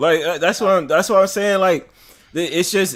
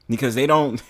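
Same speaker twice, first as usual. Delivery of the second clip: ordinary talk, nothing marked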